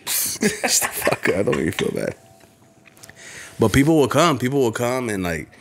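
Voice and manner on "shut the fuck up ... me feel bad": laughing. Real, not acted. Laughing at friend's joke.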